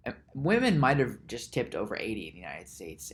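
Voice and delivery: normal talking voice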